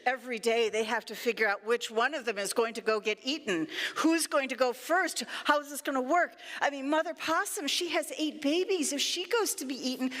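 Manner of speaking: ordinary delivery, nothing marked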